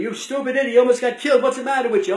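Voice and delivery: high, loud furious bawling out; angry cop yelling. Retold or imitated. Cop yells at boy nearly run over, furious shout. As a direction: scream at the kid who almost got hit. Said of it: "the cop was yelling at me".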